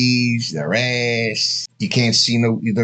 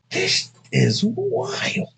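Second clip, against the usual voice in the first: amazed; 3 dB quieter, much slower than usual. Retold or imitated. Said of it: "I'm like".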